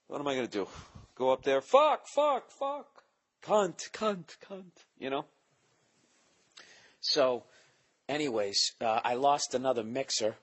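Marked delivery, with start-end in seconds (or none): 1.6-4.7 much higher, loud mock mic-test swearing; mock sound check. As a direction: test the mic with silly curses, hammed-up.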